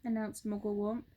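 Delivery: ordinary delivery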